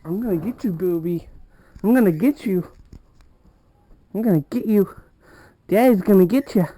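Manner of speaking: mock chase a pet; playful threat. Real, not acted.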